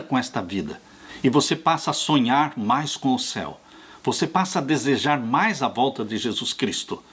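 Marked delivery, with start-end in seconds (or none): none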